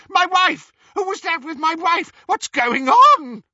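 very high, frantic yell; screaming. A narrator's reading. Husband sees his wife taken, screaming. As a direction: scream it out, building to the last word. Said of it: "the real Reg Cattermole screamed".

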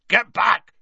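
loud, high order; shouted command. A narrator's reading. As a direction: shout to clear everyone out of the way. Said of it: "shouted Hagrid".

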